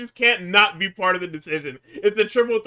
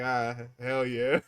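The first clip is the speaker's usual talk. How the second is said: mock cool guy; 5 semitones lower, 5 dB quieter, slower than usual. Melo voiced in mock vignette.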